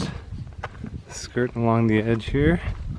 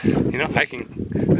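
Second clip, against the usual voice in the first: usual speaking voice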